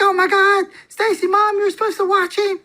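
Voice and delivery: shrill-free high-pitched panic as the wife; panicked wife. Retold or imitated. Wife scolding her mom over the escaped kid, high and alarmed. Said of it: "She's like".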